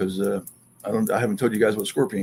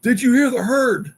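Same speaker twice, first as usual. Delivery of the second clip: high, louder alarmed question; alarmed friend; 12 semitones higher, 5 dB louder than usual. Retold or imitated. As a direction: friend calling out the herd in alarm. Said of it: "And Matt's like".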